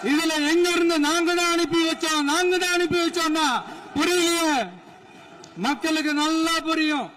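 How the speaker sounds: high-pitched rally oratory, climbing as it goes; loud speechmaking. Real, not acted. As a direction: project over a big crowd, taunting the opponents. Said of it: "நான் ஏன் கொஞ்சம் சவுண்டா பேசுறேன்".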